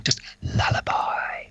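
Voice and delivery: slow, even whisper; simple hushed lullaby; plain whisper. Shown as a demonstration. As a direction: whisper it straight, not spooky. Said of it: "not weird this time".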